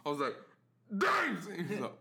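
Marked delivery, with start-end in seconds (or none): 1.0-1.5 loud, high shouted name; yelling for attention. Retold or imitated. Bartender yelling at the oblivious security guard, sharp shout. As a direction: bellow it to break his tunnel vision.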